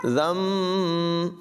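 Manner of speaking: ज़म with a soft ذ and no hiss; soft zaal, no whistle. Shown as a demonstration. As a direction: say ज़म softly, no whistle, with nasal म. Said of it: "बग़ैर सीटी के पढ़ेंगे soft आवाज़ में". (ज़म बग़ैर सीटी के, नर्म आवाज़ में)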